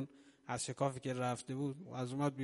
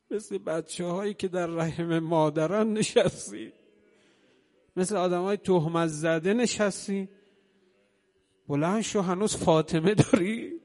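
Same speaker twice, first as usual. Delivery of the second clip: loud, higher impassioned pulpit cry; preaching at full force; 3 semitones higher, 9 dB louder than usual. Acted. Preacher voices Zahra urging Ali to stand, full-force preaching. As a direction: urge him up with all your voice.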